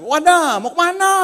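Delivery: loud, high Tangerang-style greeting; mock a blunt Tangerang speaker, Tangerang accent. Preacher imitating a Tangerang woman's blunt Tangerang accent. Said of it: "ngomongnya mantap".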